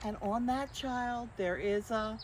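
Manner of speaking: lightly lilting picture-book narration; reading to children. A narrator's reading. Librarian reading the book to children, gentle storytime. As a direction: read it gently to little listeners.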